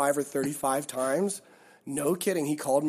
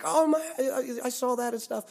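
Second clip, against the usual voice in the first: high-pitched fan gushing, imitated; excited; 11 semitones higher, faster than usual. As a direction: stumble over the words like a thrilled fan. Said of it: "He was just so excited".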